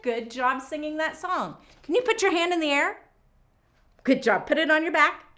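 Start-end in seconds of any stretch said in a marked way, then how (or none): none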